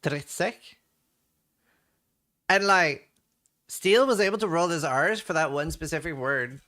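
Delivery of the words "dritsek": dritsek said with a strongly rolled R; rolled R demo. Shown as a demonstration. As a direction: trill the R in dritsek. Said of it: "And then say".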